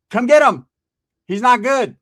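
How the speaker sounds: loud, high-pitched order; imitating an exasperated woman on the phone; urgent demand. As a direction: order the caller over at once. Woman orders him to come.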